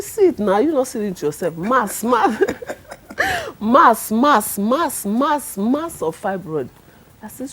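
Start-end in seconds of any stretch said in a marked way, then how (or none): 0.0-4.1 loud, insistent doctor jabbing at the scan; mock yell of the alarmed lab doctor; retold scream. Lab doctor scolding the patient over her huge fibroid, retold loud yell. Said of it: "the man was screaming at me". 4.1-6.7 loud, insistent doctor jabbing at the scan; mock yell of the alarmed lab doctor; retold scream. Lab doctor scolding the patient over her huge fibroid, retold loud yell. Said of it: "the man was screaming at me".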